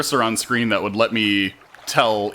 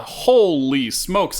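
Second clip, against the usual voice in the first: higher, slowly drawn-out holy smokes; slow amazement; 8 semitones higher, 5 dB louder, much slower than usual. Real, not acted. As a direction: let the awe stretch every word. Reacts to many hotkeys.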